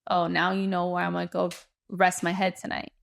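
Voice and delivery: usual speaking voice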